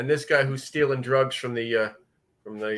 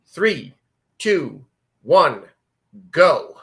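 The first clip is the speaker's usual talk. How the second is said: raised, punchy count-in, each word separate; countdown; 2 semitones higher, 6 dB louder, much slower than usual. Real, not acted. Host counts listeners in to hit play, spaced countdown. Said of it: "I'm gonna count you in".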